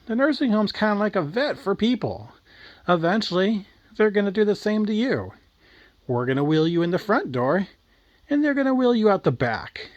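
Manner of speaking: mildly acted needling little boy; lightly voiced kid. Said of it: "And he's like".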